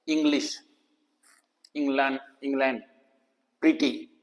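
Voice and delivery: इंग्लिश, इंग्लैंड, प्रीटी softly, each opening vowel a short "i"; short-i demo. Shown as a demonstration. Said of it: "छोटी ई की आवा". (इंग्लिश, इंग्लैंड, प्रीटी में छोटी इ, धीमे से)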